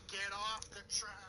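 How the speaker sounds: toned-down quote after noticing the volume; hushed reprise. Retold or imitated. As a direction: say the yell under your breath. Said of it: "you heard my volume".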